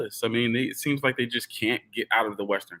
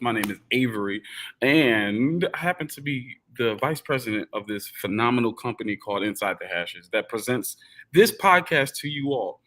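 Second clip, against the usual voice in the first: ordinary talk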